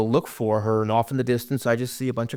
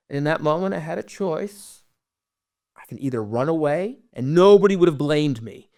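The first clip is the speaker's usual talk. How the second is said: ordinary delivery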